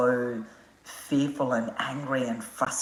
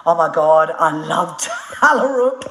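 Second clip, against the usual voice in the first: excited; 3 semitones higher, 7 dB louder, slower than usual. Real, not acted. Excited about a favourite place.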